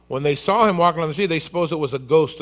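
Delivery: ordinary talk, nothing marked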